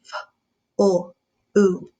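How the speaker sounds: F then oo, each sound apart. Shown as a demonstration. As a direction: sounds of flu spaced out. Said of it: "Say it sound by sound".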